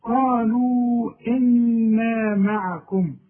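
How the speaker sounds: قالوا إنا معكم slowly with held nun; slow tajweed recitation. Shown as a demonstration. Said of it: "لازم تغن". (تلاوة بطيئة مع غنة)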